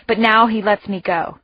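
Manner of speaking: ordinary delivery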